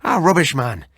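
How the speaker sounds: lightly voiced Irish friend, laughing it off; mild Irish brogue mocking old wives' tales; hearty Irish doctor, amused. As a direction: scoff like a jolly Irishman. Narrator voices Kelly.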